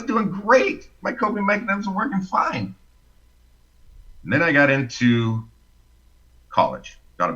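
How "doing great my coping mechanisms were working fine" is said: high-pitched, as if everything is fine; singsong self-convincing tone; insistently upbeat. Retold or imitated. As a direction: lift the voice high in mock reassurance. Voicing his past self-deception.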